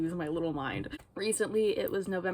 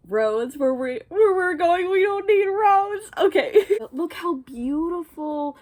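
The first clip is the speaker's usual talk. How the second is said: high, loud, near-crying recital; overjoyed, voice going high and loud; tearful fan squeal; 10 semitones higher, 11 dB louder than usual. Real, not acted. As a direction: read the famous line overcome with joy. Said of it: "on the back it says".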